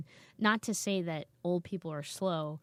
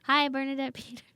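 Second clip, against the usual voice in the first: bright, high, louder call-out; cheery hello to a star; 8 semitones higher, 7 dB louder than usual. Real, not acted. Guest calls out to [name], hoping she'll come, with a playful shout-out. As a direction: hail her like she might be listening.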